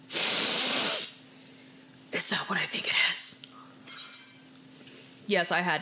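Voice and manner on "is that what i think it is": hushed, breathless whisper. Real, not acted. Whispering while sniffing a suspicious sack. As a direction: whisper it as the smell hits.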